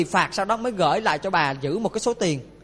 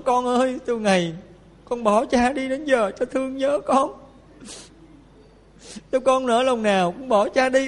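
slow, higher, tearful-leaning plea; grieving father; 4 semitones higher, slower than usual. Retold or imitated. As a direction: mourn the lost son gently. Father grieving to his dead son. (giọng người cha nhớ con, chậm rãi)

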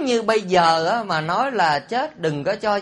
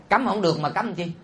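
ordinary talk

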